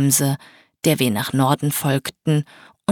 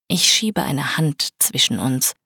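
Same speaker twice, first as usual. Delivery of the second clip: plain reading voice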